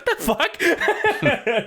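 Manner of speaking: very slow, high, laughing disbelief; incredulous laugh. Retold or imitated. Mocking the character who dropped the gun, slow laughing disbelief. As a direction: stretch it out, laughing at how dumb it is. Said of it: "It's like".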